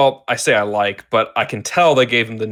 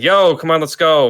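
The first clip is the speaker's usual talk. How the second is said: louder, higher quoted call to come along; casual urging call; 6 semitones higher, 5 dB louder than usual. Retold or imitated. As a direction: imitate someone rallying a friend. Voicing Wonder Woman urging Superman.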